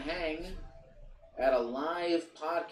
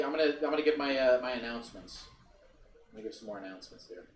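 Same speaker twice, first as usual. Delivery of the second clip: ordinary delivery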